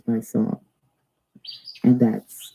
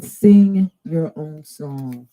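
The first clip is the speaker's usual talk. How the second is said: slow, drawn-out card reading; solemn slow oracle intoning; intoned slowly; 3 dB louder, much slower than usual. Acted. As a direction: let each word hang like a message.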